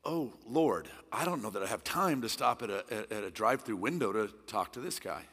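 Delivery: a hint of a weary complaint; reluctant prayer. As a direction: mumble the excuse reluctantly. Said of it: "And I said".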